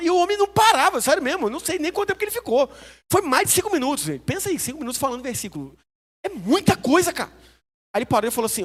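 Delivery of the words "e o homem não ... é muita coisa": loud, high and breathless; amazed, high and loud about the endless verses. Real, not acted. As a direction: marvel at how long he went on.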